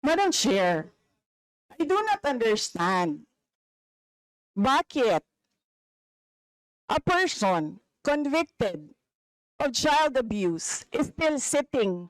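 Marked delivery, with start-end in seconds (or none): 1.8-12.1 slow, with long pauses between phrases; deliberate pointed accusation. Real, not acted. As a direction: drop it piece by piece, letting each phrase land. Pointed accusation in a heated hearing.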